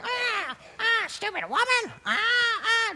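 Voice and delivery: very high, screechy parrot cries; squawking parrot. Acted. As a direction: squawk from inside the freezer. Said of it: "you could hear the bird going".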